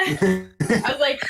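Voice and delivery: normal talking voice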